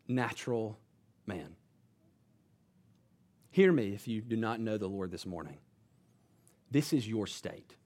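quiet earnest altar-call plea; hushed solemn preaching. Acted. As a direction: lean in and warn them quietly. Solemn closing appeal to hearers.

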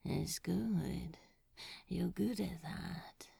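drowsy, fading whisper; half asleep. A narrator's reading. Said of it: "half asleep".

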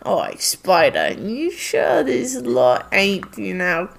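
low, slow Cockney goblin; suspicious Cockney guard, dragging it out; deep slow Cockney suspicion; gruff Cockney goblin. Acted. Goblin voiced suspicious of players.

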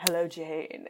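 creepy greeting. Retold or imitated. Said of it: "creepy as fuck".

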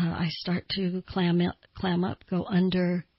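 ordinary talk

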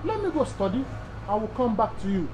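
soft, modest promise to return; humble admission. Shown as a demonstration. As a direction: say it quietly, no pride. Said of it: "when you tell people".

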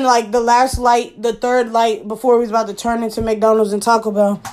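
ordinary talk

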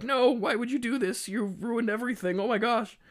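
high-pitched mock despair; Adam. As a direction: wail it like a hammed-up meltdown. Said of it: "Adam gets very upset".

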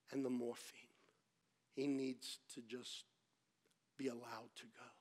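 low, soft, nearly whispered; hushed, gentle urging. Retold or imitated. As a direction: break it gently to the wife. Nurses gently urge wife to let go.

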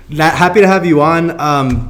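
ordinary talk, nothing marked

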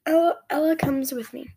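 ordinary delivery

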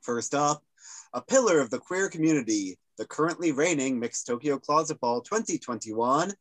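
usual speaking voice

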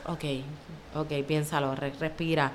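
low, hushed talk to herself; self-calming. Retold or imitated. Nurse calming herself on a hard workday, low and soft. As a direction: talk yourself down quietly.

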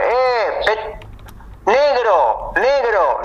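high-pitched call to someone far off; drawn-out high call. Acted. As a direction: hail someone who has their back turned. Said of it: "empezás a decirle".

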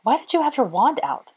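high, startled question; gasped. A narrator's reading. Hermione stumbling in the dark, gasped. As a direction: ask it in high-pitched alarm. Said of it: "she gasped".

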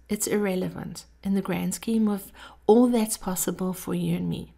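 normal talking voice

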